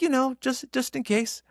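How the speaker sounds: high-pitched offhand excuse; imitated mother-in-law. Mother-in-law justifying her stash, high imitated excuse.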